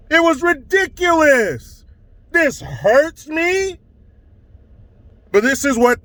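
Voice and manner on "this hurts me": a slow, mock-trembling whine; mock his voice shaking; shaking-voice imitation. Comedian imitating the pundit's shaking voice, slow and mock-trembling. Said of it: "the way this fool's voice was shaking".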